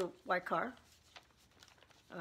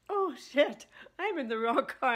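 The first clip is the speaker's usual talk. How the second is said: louder, high startled oh shit; imagined sudden panic; 5 semitones higher, 7 dB louder than usual. Retold or imitated. Retelling her friend's panic in the wrong car, loud. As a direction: blurt it like the moment of panic. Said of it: "all of a sudden saying".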